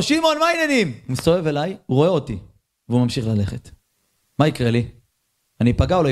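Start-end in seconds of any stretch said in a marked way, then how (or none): 0.0-0.9 high friendly shout across the street; cheerful hail from a distance; calling out. Retold or imitated. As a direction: call out to a friend on the far side of the street. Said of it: "ואני צועק לו".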